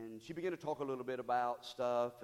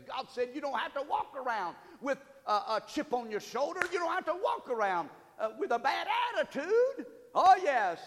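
much higher and louder, building call to the church; preaching at full force; 11 semitones higher, 9 dB louder than usual. Acted. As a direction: hammer each question home to the congregation. Preaching climax.

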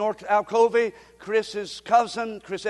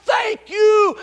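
high, slow, loud cry of thanks; loud shout of thanks; 11 semitones higher, 9 dB louder, much slower than usual. Acted. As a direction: cry it out to heaven.

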